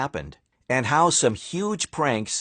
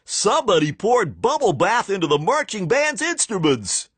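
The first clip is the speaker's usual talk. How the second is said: indignant fan; 7 semitones higher than usual. Acted. Fan complaining from stands.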